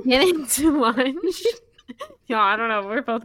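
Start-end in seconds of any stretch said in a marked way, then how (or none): 0.1-1.4 drawn out. Acted. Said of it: "drawn-out resumption of story".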